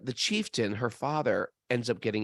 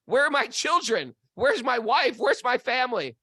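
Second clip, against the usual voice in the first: higher, louder acted desperation; frantic questioning; 12 semitones higher, 4 dB louder than usual. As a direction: play a man frantic for his lost family. Man demands his lost family.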